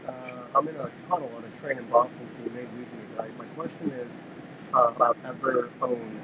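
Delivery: barely audible, bad phone line; faint voice from a train tunnel. Real, not acted. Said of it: "You sound very faint".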